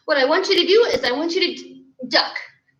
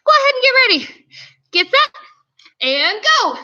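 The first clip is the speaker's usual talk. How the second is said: ordinary talk, nothing marked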